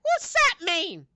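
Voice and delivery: high, slow squeaky challenge; suspicious rabbit. Acted. Rabbit doubting the player's words. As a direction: draw it out suspiciously.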